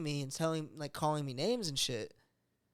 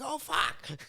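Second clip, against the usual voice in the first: startled; 10 semitones higher than usual. Retold or imitated. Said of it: "she's like".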